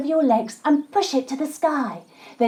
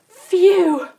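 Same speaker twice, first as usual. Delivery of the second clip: relief; 5 semitones higher, 6 dB louder than usual. Pretend. Bee escapes dragonfly.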